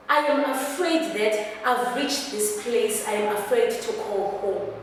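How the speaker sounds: "I am afraid" said straight on, without pauses; run-through monologue delivery. Acted. Actor telling Mama she is afraid, unbroken delivery. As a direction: run the confession together in one stretch. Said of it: "take a deep breath".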